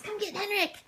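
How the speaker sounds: ordinary talk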